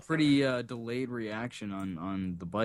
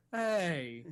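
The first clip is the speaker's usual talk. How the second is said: Sir Lancelot; 5 semitones higher, 3 dB quieter than usual. Retold or imitated. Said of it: "like the Sir Lancelot".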